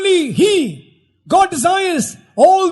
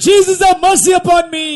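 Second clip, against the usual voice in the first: loud, raised plea; crying out for mercy; 4 semitones higher, 5 dB louder than usual. Acted. Interpreter leads the congregation's cry, loud and pleading. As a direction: shout the prayer out for everyone.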